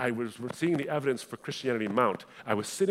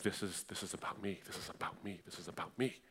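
much softer, to himself; an uneasy thought repeated under the voice; uncomfortable inner thought; 2 semitones lower, 13 dB quieter than usual. Retold or imitated. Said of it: "I'm getting more uncomfortable".

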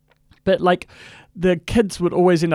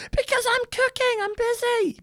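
high squeaky imitated wife; mock wife yelling; 4 dB quieter than usual. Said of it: "The wife yells".

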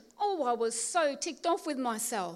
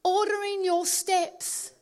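slow preaching emphasis; 4 semitones higher, 6 dB louder, slower than usual. Acted.

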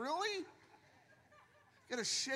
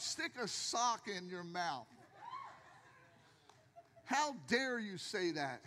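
ordinary delivery

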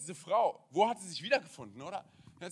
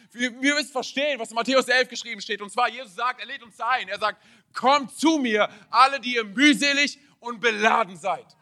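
raised, urgent sermon voice; impassioned preaching; 6 semitones higher, 11 dB louder than usual. Real, not acted. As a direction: urge the congregation to understand. (eindringlich predigend)

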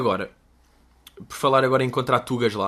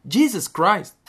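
a high, raised "Jesus Christ." exclamation; exclaiming Jesus Christ; 7 semitones higher, 4 dB louder, much slower than usual. Shown as a demonstration. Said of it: "Em vez de, tipo".

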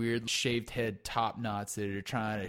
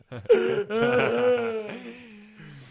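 loud cackling laugh, much higher; 12 semitones higher, 8 dB louder than usual. Real, not acted. Cracking up at his own sex doll joke, high cackle.

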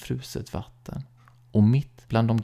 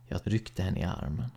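ordinary delivery, nothing marked

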